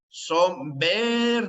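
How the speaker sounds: drawn-out, deliberate teacher stress; slow emphasis. Shown as a demonstration. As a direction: stretch the new word out slowly so it sinks in. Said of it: "teacher stresses new term".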